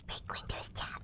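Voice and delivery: egging on in a whisper; mischievous whisper. Acted. Co-host whispering to the reader to break windows. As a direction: whisper it like a sly dare.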